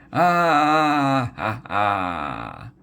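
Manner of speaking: high scream breaking into a gasp; a shriek that falls apart; panicked scream. A narrator's reading. Modi sees the ghosts, high panicked scream. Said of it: "and screamed again".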